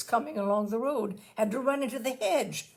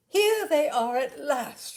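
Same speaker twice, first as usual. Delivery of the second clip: a slow, loud, delighted cry; cried out; 6 semitones higher, 9 dB louder, slower than usual. A narrator's reading. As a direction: call out gladly as if they're finally home. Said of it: "she cried".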